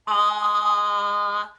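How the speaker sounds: a loud, held, open "Ah"; short O as ah. Shown as a demonstration. Teacher modelling the short O sound for the class. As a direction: sing out "Ah" as the short O. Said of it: "starts with ah".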